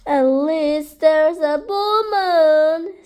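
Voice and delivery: loud, slow, stretched-out singsong; singsong cheer. A narrator's reading. Rabbit consoling Lion in the dark, slow loud singsong. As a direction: draw it out brightly, nearly sung.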